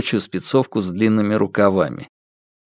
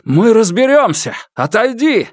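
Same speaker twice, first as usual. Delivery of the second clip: urgent shout; 12 semitones higher, 4 dB louder than usual. Acted. Officer shouts to father.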